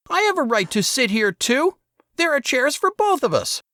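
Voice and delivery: put-on high, resentful kid brother voice; boy Saul, whining. A narrator's reading. Saul snaps at his older brother, high and resentful. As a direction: sneer it like a bratty younger boy.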